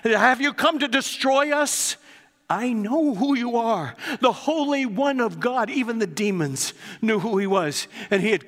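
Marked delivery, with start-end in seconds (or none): 0.0-5.7 a spirit shrieking back at its master; crying demon. Acted. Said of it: "he cried out".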